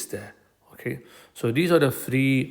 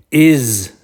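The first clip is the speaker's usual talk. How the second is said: "is" alone, long and loud; stress on "is"; 8 dB louder than usual. Shown as a demonstration. Teacher shows which word gets emphasized, stressing "is". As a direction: punch out "is" and hold it.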